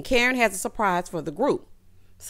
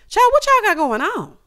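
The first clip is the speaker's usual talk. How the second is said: high, loud, bewildered exclamation; exasperated, confused cry; baffled outburst; 12 semitones higher, 7 dB louder than usual. Real, not acted. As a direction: demand an explanation, loud and high.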